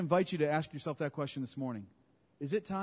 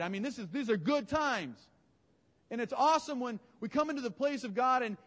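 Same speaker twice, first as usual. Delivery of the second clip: higher, louder, building excitement; fired-up preaching; 9 semitones higher, 3 dB louder than usual. Acted. Pastor rallying the congregation about glorious times, fired up. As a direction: preach it to the rafters.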